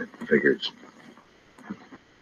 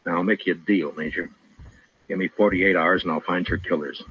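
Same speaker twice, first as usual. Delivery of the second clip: ordinary talk, nothing marked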